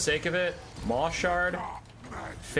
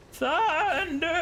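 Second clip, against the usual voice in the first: high, drawn-out battle cry; unit voice lines. Retold or imitated. Gamer imitates the Thunder Tusk's voice lines, high and drawn out. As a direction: bellow like a monster unit. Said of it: "it just keeps going thunder".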